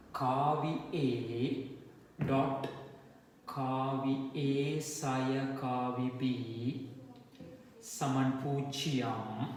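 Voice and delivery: very slow, drawn-out formula dictation; stretched words with gaps for writing. Real, not acted. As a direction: spell out the equation symbol by symbol. Dictates vector equation slowly.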